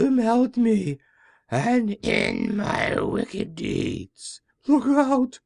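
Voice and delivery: high, slow witch voice, wailing to the end; a doomed old witch says goodbye as she melts; high, drawn-out witch lament rising to a final cry; melting witch. Acted.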